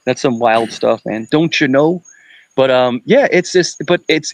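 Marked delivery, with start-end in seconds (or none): 1.3-2.0 a sing-song Upper Midwest "Don't you know"; mock North Dakota accent. Host riffing on Fargo speech, mock North Dakota accent. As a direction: tease the North Dakota twang lightly. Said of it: "North Dakota, like, that diction".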